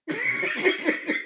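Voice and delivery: high-pitched burst of laughter; amused laugh. Real, not acted. Storyteller laughing at a name mix-up, high giggle. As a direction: laugh high at your own rant.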